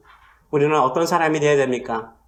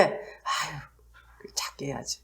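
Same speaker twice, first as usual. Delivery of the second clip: very soft, half-whispered bashful murmur; hushed mock embarrassment; mock timid hush; 3 semitones lower, 10 dB quieter than usual. Shown as a demonstration. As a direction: shrink the voice as if too shy to be heard. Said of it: "작게 한 게 아니라".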